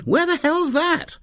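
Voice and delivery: high, slow disbelieving outburst; incredulous. Acted. Exasperated disbelief.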